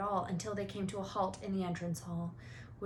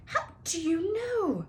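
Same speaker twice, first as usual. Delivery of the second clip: higher, slow, shocked sputter; spluttering; 11 semitones higher, 5 dB louder, slower than usual. Acted. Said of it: "she spluttered".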